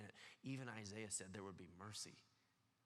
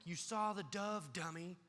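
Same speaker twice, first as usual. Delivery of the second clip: higher, louder and slow, scolding; mock angry rebuke; 6 semitones higher, 4 dB louder, slower than usual. Jesus mock-scolding a doubting John, slow and louder. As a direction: scold him like a fed-up teacher. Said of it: "in anger of like".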